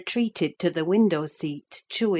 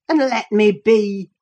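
loud, raised angry demand; ferocious; 4 semitones higher, 8 dB louder than usual. A narrator's reading. Cousin wants Catherine to leave him alone, fierce and loud. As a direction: throw it out fiercely, pitch lifting. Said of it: "he exclaimed ferociously".

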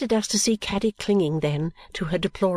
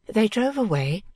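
usual speaking voice

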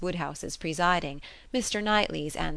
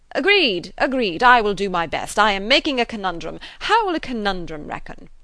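loud, raised, hearty enthusiasm; cried out; 4 semitones higher, 11 dB louder than usual. A narrator's reading. Mister Weston joining the game, hearty cry. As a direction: boom agreement to the company. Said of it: "cried Mister Weston".

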